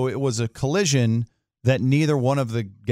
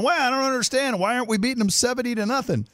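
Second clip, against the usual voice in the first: much higher, fast whiny fan voice; mock panicking fan; 13 semitones higher than usual. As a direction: play the overreacting fan on social media. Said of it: "people were freaking out, going".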